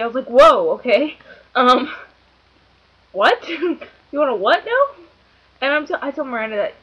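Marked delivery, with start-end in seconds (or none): 0.3-1.9 loud, startled whoa; retold shock, punched loud; taken aback. Retells shock at crude message. 3.1-5.0 loud, high-pitched incredulous question; mock shock, pitch jumping up; amused disbelief. Retells disbelief at crude message.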